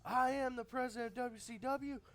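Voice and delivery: high, loud strutting Flair promo voice; Ric Flair impression. The booker mocks the wrestlers as Flair, loud and high. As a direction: bark it out like a cocky wrestling boss.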